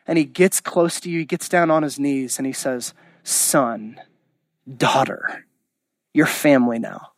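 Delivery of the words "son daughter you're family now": put-on kneel down and speak gently to the child; God kneeling before a forgiven child, tenderly; tender father.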